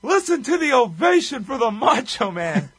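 loud, high-pitched excited announcer call; Bobby Heenan. Retold or imitated. Podcast host quotes the commentator's line of the match, excited announcer call. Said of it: "Bobby Heenan had the line of the match when he said".

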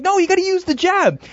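high yelled advice to the fighter; retold yell. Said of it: "he yells at him".

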